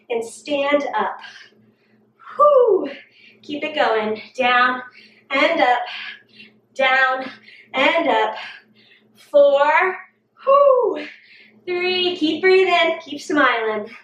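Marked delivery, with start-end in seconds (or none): 2.4-2.9 winded whew. Real, not acted. Trainer mid-workout exhales. 10.4-11.1 winded whew. Real, not acted. Counting reps, exhales.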